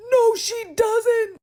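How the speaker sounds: very high, drawn-out yell; exasperated outburst. Real, not acted. Vlogger rebutting the film's claim about her accent, exasperated yell. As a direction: yell the rebuttal, stretching every word.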